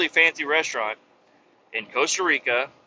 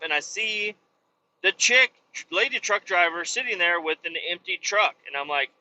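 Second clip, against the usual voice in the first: ordinary talk, nothing marked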